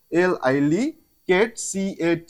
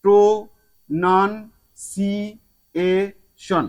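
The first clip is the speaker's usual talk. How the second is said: Pronunciation stretched out very slowly; teacher drawing out pronunciation; slow demo word; 2 semitones higher, much slower than usual. Shown as a demonstration. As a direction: model pronunciation syllable by syllable. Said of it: "এই ওয়ার্ডটাকে আমি এখানে তুলে ধরলাম".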